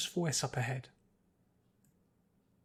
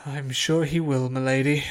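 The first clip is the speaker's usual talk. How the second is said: a tired butler humouring his mistress; put-upon butler; 8 dB louder than usual. A narrator's reading. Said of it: "an old butler who appeared very put upon".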